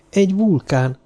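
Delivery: plain reading voice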